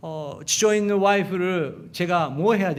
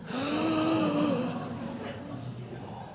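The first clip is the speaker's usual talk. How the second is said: shocked gasp; 10 semitones higher, 7 dB quieter than usual. Retold or imitated. Reacting to son's words.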